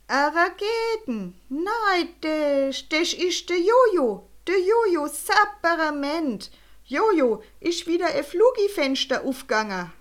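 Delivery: put-on high, loud Swiss German old balloonist, delighted; an old Swiss balloonist spotting a flying boy, thrilled; excited Swiss balloonist. A narrator's reading.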